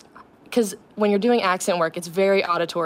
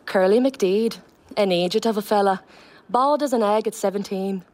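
line read in a Donegal lilt; Donegal accent. Shown as a demonstration. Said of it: "I'll just do a line from the play".

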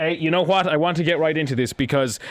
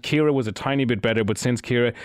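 ordinary talk

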